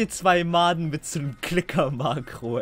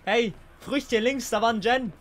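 ordinary delivery

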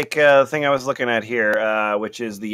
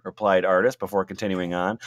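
usual speaking voice

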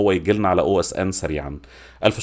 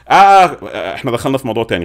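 sudden realization; 3 semitones higher, 7 dB louder than usual. Real, not acted. Catches himself drifting off topic.

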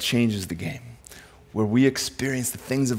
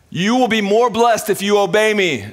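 high, emphatic dad voice; mock-stern parent; 8 semitones higher, 4 dB louder than usual. Dad promising his kids a blessing, mock-stern. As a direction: lay down the rule to the kids, pitched up. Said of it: "This is how I talk in my house".

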